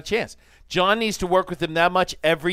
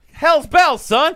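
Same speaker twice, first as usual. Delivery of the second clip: folksy shout; 10 semitones higher, 7 dB louder, slower than usual. Pretend. Folksy exclamation.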